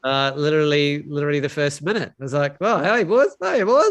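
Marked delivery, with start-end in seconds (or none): 2.6-3.3 put-on high, loud cheery call of "boys"; excited greeting. Greeting new mates on arrival. 3.4-3.9 put-on much higher, louder "boys", rising; very excited greeting. Greeting new mates on arrival.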